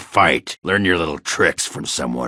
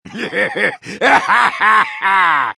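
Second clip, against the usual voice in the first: big roaring belly laugh, pitched way up; booming laugh; 5 dB louder than usual. Acted. Dwarf roaring with laughter at his friend's triumph. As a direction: throw back your head and roar with laughter.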